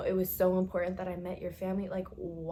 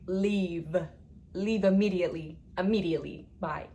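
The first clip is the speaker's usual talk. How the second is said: preachy advice; 5 dB louder than usual. Retold or imitated. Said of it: "the girl that preached".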